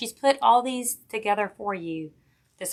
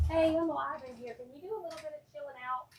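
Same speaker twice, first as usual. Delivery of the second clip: hushed aside; 6 semitones higher, 9 dB quieter, faster than usual. Real, not acted. Telling her son to quiet down.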